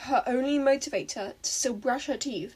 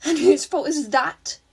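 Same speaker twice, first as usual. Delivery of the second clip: louder, sharp retort; snapping; 3 semitones higher, 8 dB louder than usual. A narrator's reading. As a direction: bite back at her. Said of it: "Ochako snapped".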